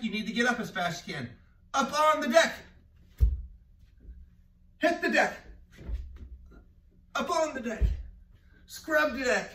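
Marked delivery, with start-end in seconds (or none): 1.7-7.8 high, loud game call; called command. Shown as a demonstration. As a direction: call it out for the kids. Teacher calls game command.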